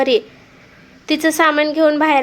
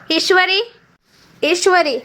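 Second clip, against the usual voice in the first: raised, calling her name twice from the next room; calling loudly; 5 semitones higher than usual. A narrator's reading. Husband calling sleeping wife to wake up, loud. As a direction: call her awake, louder the second time. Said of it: "तिला मोठ्याने हाक मारली".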